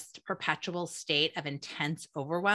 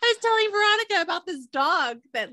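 laughing; 12 semitones higher, 5 dB louder than usual. Real, not acted. Telling it through laughter or tears.

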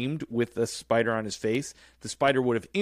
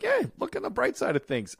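high, cheery goofball voice; happy-go-lucky Marv; 12 semitones higher than usual. Retold or imitated. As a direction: chirp it like a dopey, sunny crook. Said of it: "Marv is just always like".

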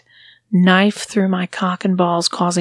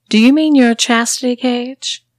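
higher, louder mock question; amused mistress; 5 semitones higher, 6 dB louder than usual. Mistress names the cage he asked about, amused. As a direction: correct him with relish.

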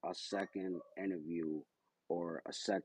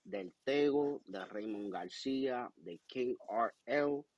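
big show-closing announcer; 3 semitones higher than usual. Acted. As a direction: sign off the show like a ring announcer. Host signs off show in Spanish.

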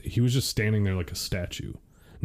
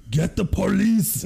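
high slow shout; retold shout; 10 semitones higher, 4 dB louder than usual. Husband retelling shouting to wife, higher and slow. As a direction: call out for the police, drawn out. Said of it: "I was like".

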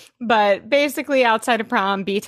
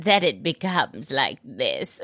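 put-on lower older voice; 6 semitones lower, 3 dB quieter than usual. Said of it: "It's like Lily's voice".

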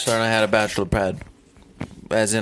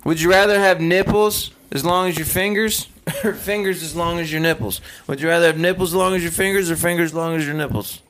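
playful country twang; country accent; 6 semitones higher than usual. Shown as a demonstration. Co-host asks the next question on request, country accent. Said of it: "next question with that country accent".

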